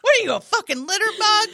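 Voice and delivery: high, loud indignant carjacker; mock outraged scolding. Acted carjacker scolding over litter.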